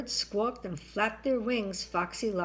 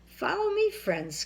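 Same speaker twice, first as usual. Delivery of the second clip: sly fox voice; 9 semitones higher, 5 dB louder than usual. A narrator's reading. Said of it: "cried Foxy Loxy".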